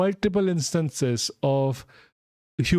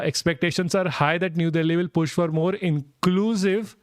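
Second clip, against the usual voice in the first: ordinary delivery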